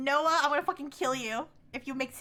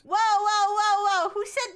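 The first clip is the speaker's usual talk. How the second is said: higher and louder repeated whoa; loud protest; 7 semitones higher, 7 dB louder than usual. Real, not acted. Streamer shutting down the date remark, loud. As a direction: cut him off hard.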